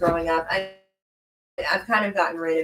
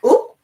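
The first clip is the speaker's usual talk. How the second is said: mock oop; 6 semitones higher, 7 dB louder than usual. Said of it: "you're like".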